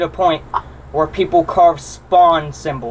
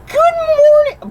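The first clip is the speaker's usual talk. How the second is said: very high, slow greeting; high sing-song; 3 dB louder than usual. Pretend. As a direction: chirp it way up high.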